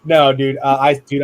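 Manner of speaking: ordinary talk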